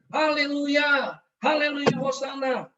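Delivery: high, loud hallelujah shout; jubilant cheering. Retold or imitated. Testifier reliving heavenly praise, cheering loudly. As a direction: cry out in praise, drawing out the word. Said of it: "Saya bersorak".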